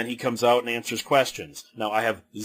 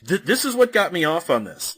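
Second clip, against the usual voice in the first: normal talking voice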